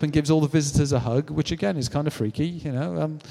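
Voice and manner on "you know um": usual speaking voice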